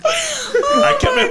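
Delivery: squeaky high laughing words. Real, not acted. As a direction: talk through helpless laughter. Laughing after losing composure.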